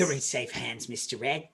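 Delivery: low, hushed reassurance. Acted. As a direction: keep it secret, soft and low. Secret confided, hushed reassurance.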